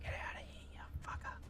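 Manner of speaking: hushed whisper so the enemy won't hear; tense stealth whisper. Real, not acted. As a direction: whisper low while sneaking past. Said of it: "you guys can hear me whispering".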